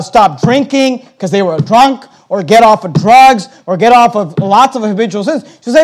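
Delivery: ordinary talk, nothing marked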